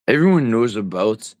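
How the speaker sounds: exaggerated attempt at a Canadian accent; bad Canadian accent. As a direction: put on a hammy Canadian "aboot". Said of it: "I was gonna do a Canadian accent".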